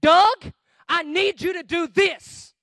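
loud, high, slow command; forceful, measured demand; blunt pastor's order. Acted. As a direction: drive every word home loudly. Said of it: "so the pastor can say".

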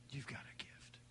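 God's voice as a hushed whisper; barely audible, breath-only whisper. Acted. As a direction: whisper it over each listener. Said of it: "God is whispering over each".

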